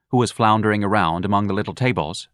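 ordinary delivery

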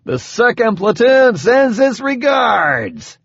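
slow, high-pitched booming announcement; big booming voice. A narrator's reading. Harvey announcing himself to the Oban before firing, big booming voice. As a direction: draw it out like a grand proclamation. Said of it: "said in a big, booming voice".

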